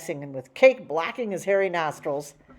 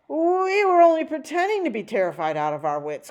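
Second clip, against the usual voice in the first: shaky boast; 10 semitones higher, 6 dB louder than usual. Acted. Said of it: "cried Hacky Macky".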